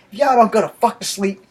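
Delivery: fed-up father laying down the law; exasperated dad. Retold or imitated. Dad sending the boys to sleep, exasperated.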